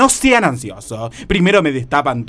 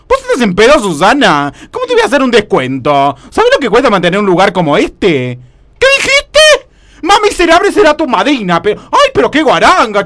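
a los gritos, aguda y ofendida; subí la voz, escandalizada por el pedido; indignación furiosa; 6 semitones higher, 6 dB louder than usual. Acted. (indignada, gritando al teléfono)

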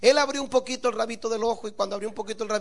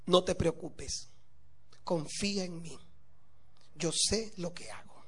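slow, low, soft and serene; calm her fears gently, almost whispering; calm reassurance; 4 semitones lower, 11 dB quieter than usual. Acted. Husband's calm reassuring refrain.

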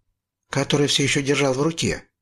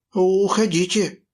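teeth chattering; 6 semitones higher than usual. A narrator's reading. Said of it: "стучал я зубами, не в силах закричать".

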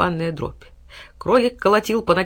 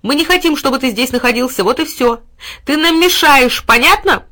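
scolding shout; 7 semitones higher, 8 dB louder than usual. A narrator's reading. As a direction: hammer it in. Boy scolds dog.

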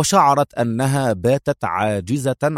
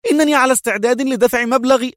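high woman's voice, fast and pushy; high, agitated businesswoman; 12 semitones higher, faster than usual. Acted. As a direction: bargain hastily, high and tense. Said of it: "تضاعفت عصبيتها".

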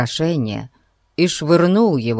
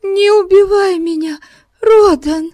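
high, louder terrified plea; pleading; 5 dB louder than usual. A narrator's reading. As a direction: beg for your life. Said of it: "взмолилась она".

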